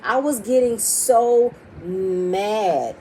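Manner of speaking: slow, stretched words, every syllable dragged; drawn-out frustration. Real, not acted. Venting to viewers, stretched out in slow exasperation. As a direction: let the anger seep through each slow word. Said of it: "I'm trying to calm down".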